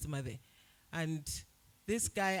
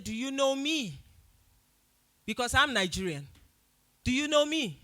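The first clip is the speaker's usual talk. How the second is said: higher, louder, rapid-fire challenge; heated confrontation; 4 semitones higher, 4 dB louder than usual. Retold or imitated. Challenging the man who insulted Nigerians, heated and louder. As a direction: demand he justify himself.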